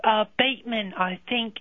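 put-on a middle-aged Londoner's British accent, a bit brash. British-accented ex ringing the radio show to scold Tommy. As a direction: play a cheeky London ex-girlfriend in British accent.

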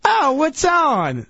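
high, drawn-out mock older woman; slow, high-pitched imitation of a woman; older lady. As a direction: imitate a nosy lady at the bar. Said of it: "this older lady comes up next to me".

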